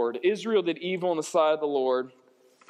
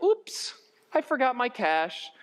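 high, light little excuse; mock-innocent oops; 5 semitones higher, slower than usual. Preacher mocking a trivial excuse, falsely innocent. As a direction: play at being harmlessly forgetful.

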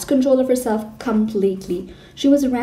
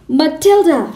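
a sharp, loud bark, pitched higher; barking shout; 6 semitones higher, 7 dB louder than usual. A narrator's reading. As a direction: snap the name out like a furious headmistress. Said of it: "she barked".

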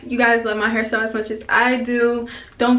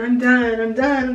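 usual speaking voice

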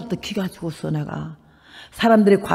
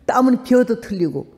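ordinary delivery

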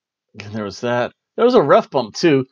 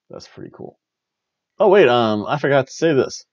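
usual speaking voice

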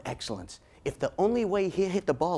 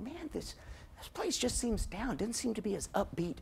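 soft, higher thinking-aloud voice; musing to oneself; 4 semitones higher, 10 dB quieter than usual. Pretend. Voices someone's private evening thought.

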